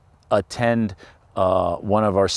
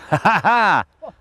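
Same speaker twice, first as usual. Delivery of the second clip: laughing; 10 semitones higher, faster than usual. Real, not acted. Laughing as hawk lands.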